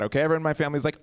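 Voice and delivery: ordinary talk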